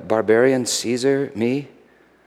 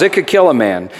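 normal talking voice

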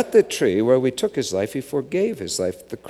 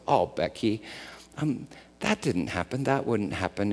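reassuring dad; 2 semitones lower, 8 dB quieter than usual. Retold or imitated. Dad reassures scared daughter.

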